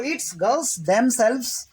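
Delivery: very slow, each word spaced out; slow demo sentence. Shown as a demonstration. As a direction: dictate it slowly for the class. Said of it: "ऐसे बोला जाएगा".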